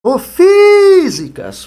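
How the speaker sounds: loud, high shout stretching the FI of "físicas"; calling at the gate. Shown as a demonstration. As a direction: holler "físicas" as if calling someone, first syllable held long. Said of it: "chamando a palavra pelo portão. Você dá um grito". (gritando como quem chama no portão)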